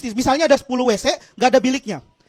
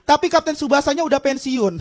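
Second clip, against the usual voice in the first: usual speaking voice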